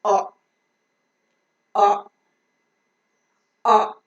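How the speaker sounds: "O" as a short, open "aw", not "oh"; short rounded vowel for each "O"; phonic O sound. Shown as a demonstration. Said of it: "normal phonic pronunciation of".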